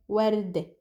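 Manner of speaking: "ورد" as "wardeh"; short eh at the end of "ورد"; Lebanese pronunciation. Shown as a demonstration. Teacher summing up the rule, Lebanese pronunciation. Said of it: "becomes an ih sound".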